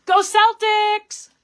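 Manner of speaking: a loud, slow, high sports cheer. Real, not acted. Crafter signing off the live stream with a loud cheer for her team. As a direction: shout it out like a fan in the stands.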